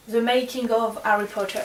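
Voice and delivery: put-on French speaker putting on an English accent for the title; proud little try at English. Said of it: "Sympa, mon accent".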